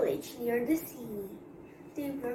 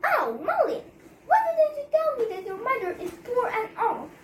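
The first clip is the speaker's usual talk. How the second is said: higher, louder, fast angry scolding; 10 semitones higher, 5 dB louder, faster than usual. Acted. Wife scolds Malin over his poor mother, angry and high. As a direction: snap at him, fast and furious. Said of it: "his wife said angrily".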